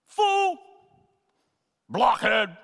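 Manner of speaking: loud, high, separate bursts of contempt; shouted insults. Acted. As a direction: hurl each name at someone like a slap. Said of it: "Both words were shouts at others".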